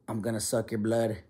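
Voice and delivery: low, quiet mock menace; lightly voiced vampire threat, soft and low; a hint of a spooky caller; creepy prank caller. Retold creepy prank call threat.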